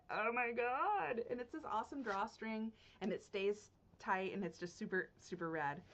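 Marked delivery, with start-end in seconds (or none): 0.1-1.2 higher, drawn-out gush; gushing over a find; excited awe. Real, not acted. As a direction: stretch it out in delighted amazement. Raving about space cats bag.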